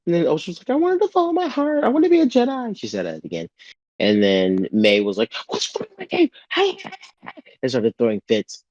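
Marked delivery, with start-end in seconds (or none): 0.7-2.8 put-on very high, quick, childish whine; mock falsetto plea. Osha quoted mockingly. 5.5-6.3 way up high, falsetto outburst; mock shrill outrage. May throwing fits.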